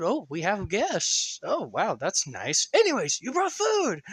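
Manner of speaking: the tinkerer shrugging off the blast; cheery high voice. Acted. Maggie notices guests.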